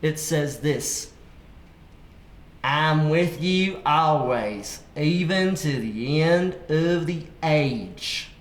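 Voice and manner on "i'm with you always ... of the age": slow country drawl; put-on country accent, very slow. Shown as a demonstration. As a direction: read it with a drawn-out country twang. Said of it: "my country accent".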